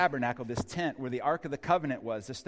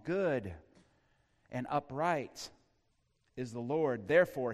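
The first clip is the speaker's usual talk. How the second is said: usual speaking voice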